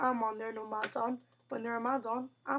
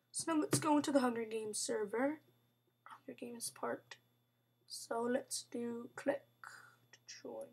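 whisper; 2 semitones lower, 7 dB quieter than usual. Real, not acted. Gamer whispering to viewers while joining the server. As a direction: hush it like a secret.

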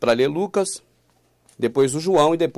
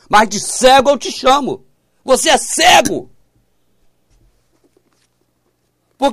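raised, high-pitched exasperated rebuke, driving each question home; heated preaching; 6 semitones higher, 5 dB louder than usual. Real, not acted. Preacher scolds listeners as blind about a corrected Bible, heated. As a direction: challenge the listeners forcefully, voice pushed up and loud.

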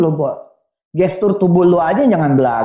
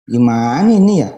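mock doubtful prayer; 5 semitones lower than usual. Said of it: "doa juga kayak begitu".

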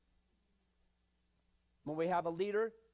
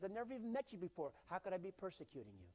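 fast, quick, flustered protest; shocked Paul; 8 dB quieter, faster than usual. Acted. Paul protesting to Jesus, shocked and fast. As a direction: rattle it off in disbelief. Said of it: "That's why he was so shocked".

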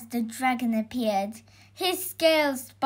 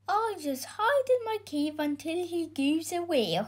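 higher-pitched dragon character; reluctant dragon; 6 semitones higher, 3 dB quieter than usual. A narrator's reading. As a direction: duck the fight as a timid dragon. Reluctant dragon plans to hide.

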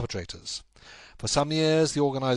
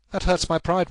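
wounded pride; 8 semitones higher, 7 dB louder than usual. Acted. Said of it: "It is a petty feeling".

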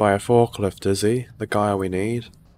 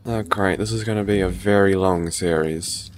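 ordinary talk

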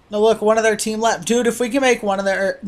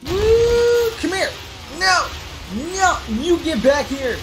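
high whoop then yelling come here; hollering after a fleeing player; excited chase yells; 8 semitones higher than usual. Real, not acted. Chasing an opponent.

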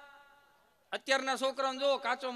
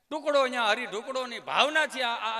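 ordinary talk

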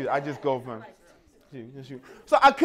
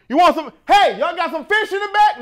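higher, louder call to the back; eager shout; 9 semitones higher, 6 dB louder than usual. Retold or imitated. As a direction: call eagerly to the kitchen staff. Bob calls to the kitchen.

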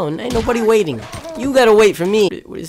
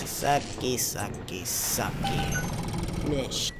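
muttering; 9 semitones lower, 12 dB quieter, much slower than usual. Real, not acted. Fading muttered chant.

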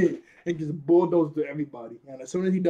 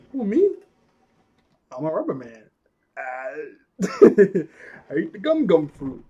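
Luffy catchphrase; 7 dB louder than usual. Retold or imitated. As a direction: proudly announce it with a laugh. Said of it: "that classic".

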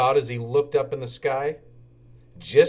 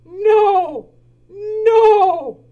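much higher hooting owl voice; 10 dB louder than usual. Acted. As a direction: hoo it out like an owl. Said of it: "said the owl".

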